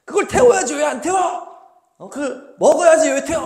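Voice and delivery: high, shouted demand for answers; angry scolding. Acted. Moses scolding the sons over the burnt offering, angry scolding. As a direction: bawl them out in exasperation. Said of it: "하면서 막 혼내니까".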